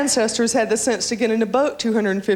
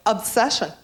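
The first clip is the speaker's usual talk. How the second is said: lightly mocked perfume-ad delivery; a hint of grand cologne-seller; cologne sprayer. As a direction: imitate a perfume pusher naming the scent, falling tone. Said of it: "when they come up and go".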